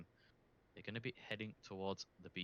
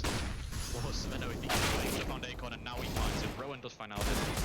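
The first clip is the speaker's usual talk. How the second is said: louder, quick kill calls; excited play-by-play; 2 semitones higher, 8 dB louder than usual. Real, not acted. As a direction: shout the frags out live. Calling a sudden multi-kill.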